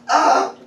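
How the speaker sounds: high imitated exclamation; Philemon's excited "ah". Preacher demonstrating Philemon's style, mock excited cry. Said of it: "Philemon style".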